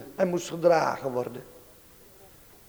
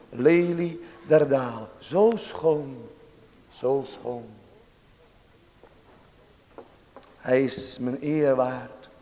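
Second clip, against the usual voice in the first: tender devotional preaching; 4 semitones lower than usual. Real, not acted. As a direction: savour each phrase in hushed reverence. Devotional close in adoration.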